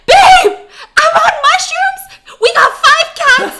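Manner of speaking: high, loud, laughing panic; hammed-up panicked husband yelling at his wife. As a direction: burst in shouting in mock terror. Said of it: "more and more panicked".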